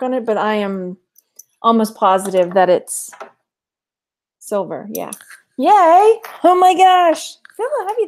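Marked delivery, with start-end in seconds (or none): 5.6-8.1 excited. Real, not acted. Thrilled at a silver find.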